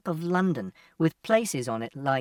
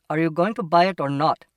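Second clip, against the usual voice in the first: louder, testy challenge; impatient shopkeeper; 5 dB louder than usual. Acted. Shopkeeper to a browsing customer, impatient. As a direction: press the customer impatiently for an answer.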